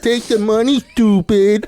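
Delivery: high, loud comic dad voice; Waterboy dad impression. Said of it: "his impression of, uh, the dad from, uh, Waterboy".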